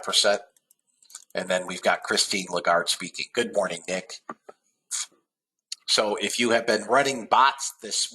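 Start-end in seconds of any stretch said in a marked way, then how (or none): none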